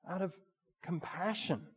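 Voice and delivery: ordinary delivery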